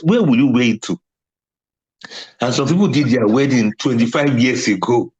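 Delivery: normal talking voice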